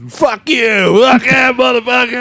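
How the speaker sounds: put-on high, loud drunken yelling; drunk yelling outside. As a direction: bellow it like a drunk idiot. Retelling friend's drunken outburst.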